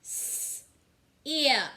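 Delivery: s whispered, then ip voiced; segmenting sounds. Shown as a demonstration. Teacher segmenting sip for young pupils, s hissed unvoiced. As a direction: split sip into s and ip.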